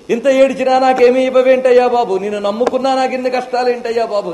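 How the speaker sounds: loud, high-pitched grumbling at God; mock complaint. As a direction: whine loudly like an aggrieved devotee. Mock complaint the devotee never made. (గొంతెత్తి ఫిర్యాదు చేస్తున్నట్టు)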